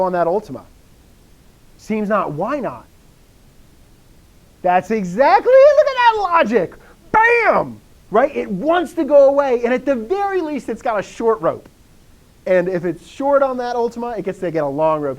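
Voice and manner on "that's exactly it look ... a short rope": loud, very high-pitched burst of delight; thrilled teacher. Real, not acted. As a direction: cheer the student's logic like a winning goal. Teacher thrilled by student's correct answer.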